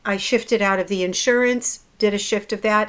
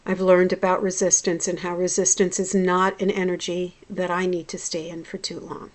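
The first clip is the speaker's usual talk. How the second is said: usual speaking voice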